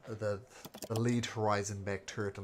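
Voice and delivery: usual speaking voice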